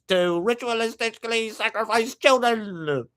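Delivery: high, loud, sneering Australian rich-guy voice; mock Australian accent. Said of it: "That's what Australian accent says to me".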